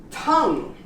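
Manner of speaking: tongue as "tung"; silent U. Shown as a demonstration. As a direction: end tongue on NG with no U. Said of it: "You don't pronounce the U".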